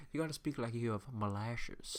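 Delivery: put-on syrupy Savannah accent. Showing friend The Office bit, mock Savannah accent. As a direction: speak like molasses, in a Savannah accent. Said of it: "It's a Savannah accent".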